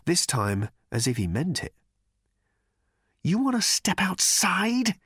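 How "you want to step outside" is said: slow, high-pitched belligerent snarl; snarled challenge. A narrator's reading. Arthur challenges Thor to step outside, snarling. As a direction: draw out the dare, spoiling for a fight. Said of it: "he snarled at Thor".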